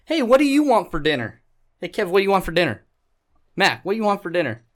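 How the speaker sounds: high, quick, repeated mock nagging; mock girlfriend nagging. Girlfriend firing the dinner question back, hammed-up mock nagging. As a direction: rattle the question off like a pestering girlfriend.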